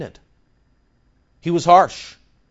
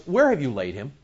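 indignant; faster than usual. Shown as a demonstration. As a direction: ask it as an indignant demand. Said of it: "The Greek says he was indignant".

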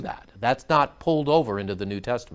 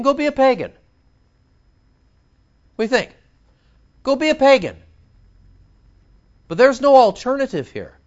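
high, heated scolding from the pulpit; full-force preaching; 8 semitones higher, 3 dB louder than usual. Acted. Preacher scolding churchgoers who skip Sunday, full force. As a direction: preach it hard, no excuses accepted.

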